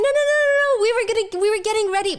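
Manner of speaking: high, fast panicked denial; imitating flustered girls; flustered high protest. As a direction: frantically wave it off, pitch jumping high. Girls freaking out, waving hands.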